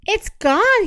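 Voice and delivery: high, loud dismayed cry; upset cry. Acted. Mister Muskrat finds the pebble gone, upset cry. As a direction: cry it out in alarm. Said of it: "he cried".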